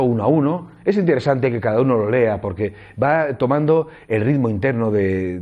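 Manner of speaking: ordinary delivery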